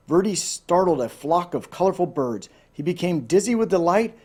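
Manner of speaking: ordinary delivery, nothing marked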